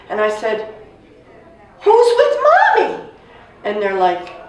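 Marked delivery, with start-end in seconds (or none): 1.8-3.0 very high, loud pleading cry; child's desperate cry. Retold or imitated. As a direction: cry out like a frightened little girl. Child demanding news of mother.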